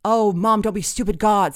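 put-on loud, high teenage exasperation; exasperated. A narrator's reading. Teenage daughter scolds her mom at dinner, exasperated. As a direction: burst out at an embarrassing parent.

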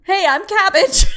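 laughing. Real, not acted. Host laughing through a botched intro retake. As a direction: fight back laughter as you say it.

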